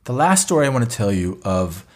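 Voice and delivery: ordinary delivery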